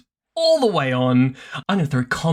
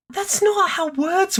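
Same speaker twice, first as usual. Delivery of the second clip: incredulous. Real, not acted. Mock-baffled objection.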